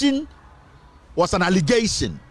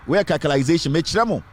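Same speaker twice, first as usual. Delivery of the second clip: normal talking voice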